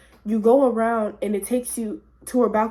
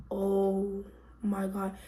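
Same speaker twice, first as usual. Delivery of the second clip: slow, soft, dazed disbelief; stunned; 3 semitones lower, 8 dB quieter, much slower than usual. Retold or imitated. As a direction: draw it out quietly, stunned. Retold stunned reaction while hallucinating.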